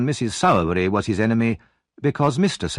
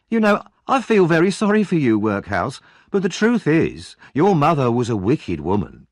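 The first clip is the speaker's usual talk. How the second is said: high, loud fake pity with a sneer; mock sympathy; 5 semitones higher, 3 dB louder than usual. Noah fakes pity for Oliver, then insults his mother. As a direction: pretend to be sorry while twisting the knife.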